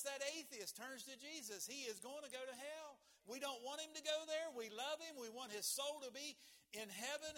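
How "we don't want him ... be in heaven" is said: higher, louder pleading; rising passionate appeal; fervent preaching. Acted. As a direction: plead for the lost soul. Preaching at full force.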